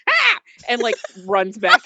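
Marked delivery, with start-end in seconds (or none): 0.1-0.4 short startled creature screech; a sudden loud "Ah!" from a lurking creature; quick sharp yelp; creature cry. Acted. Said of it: "you hear this kinda like".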